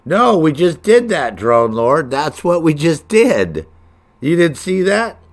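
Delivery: high, loud exasperated protest; can't believe they missed it; yelling at a teammate who missed it. Real, not acted. Arguing with teammate Drone Lord.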